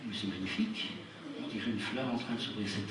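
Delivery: soft, awed murmur; hushed wonder. Acted. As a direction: marvel quietly at the flower.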